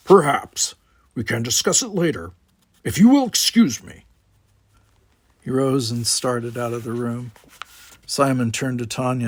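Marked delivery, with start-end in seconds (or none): 0.1-4.1 gruff Klingon, higher and louder, set apart from the narration; a proud Klingon brushing the talk aside; Worf's voice. A narrator's reading. Worf cuts Tanya off.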